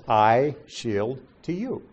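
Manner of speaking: slow, separate words with a gap after "shield"; spaced-out word-for-word reading; literal wording. Shown as a demonstration. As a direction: set "I", "shield", "to you" apart. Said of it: "That's not what it says".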